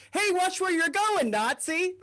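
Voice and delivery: a mildly hammed-up "watch it" yell; mock angry snap. Stranger snapping after being bumped by the freezer door. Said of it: "his knee-jerk frozen door hit reaction was".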